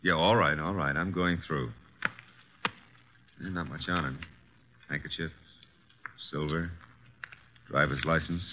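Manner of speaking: soft, low murmur listing the finds; half under the breath, uneasy; reluctant mutter. Acted. Going through the dead man's pockets, murmuring softly.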